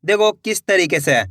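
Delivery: normal talking voice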